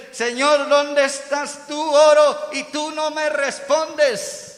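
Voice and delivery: high, loud, drawn-out plea; anguished cry to God. Acted. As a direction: call out slowly to a silent God. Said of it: "ante este clamor del profeta".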